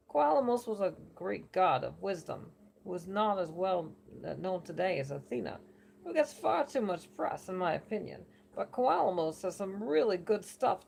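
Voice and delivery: chatty New York oracle holding court; lightly done New Yorker accent; bad New Yorker accent. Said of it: "do it in a New Yorker accent".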